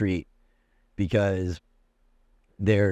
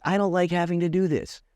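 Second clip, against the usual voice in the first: high-pitched, exasperated comeback; irritated retort; 10 semitones higher than usual. Retold or imitated. Patient arguing with a stubborn doctor, irritated retort. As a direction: push the pitch way up in protest.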